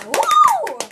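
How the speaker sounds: loud high woo; cheering. Real, not acted. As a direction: cheer the guest. Cheering for the guest.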